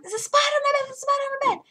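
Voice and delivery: high, loud frantic repeated cry; panicked shriek. Retold or imitated. Girl telling dad about the spider, frantic high shriek. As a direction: squeal it in terror. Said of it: "'Cause I'm like".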